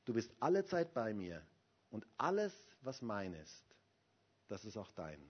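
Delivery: gentle pastoral invitation; tender. Acted. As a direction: soften and draw the listener close. Quiet invitation, closing.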